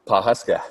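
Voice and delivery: "Pawhuska" with an open "paw" at the start; full first syllable. Real, not acted. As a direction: say "Pawhuska" as paw-HUS-kuh. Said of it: "that's what I say".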